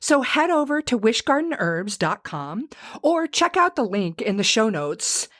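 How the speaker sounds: upbeat, high-pitched sponsor read. Acted. Host urging allergy sufferers to try a remedy, upbeat ad read.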